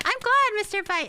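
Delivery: gasp, then high bright glad; sudden thrilled gasp; delighted gasp. Real, not acted. Streamer gasping happily at a viewer's message.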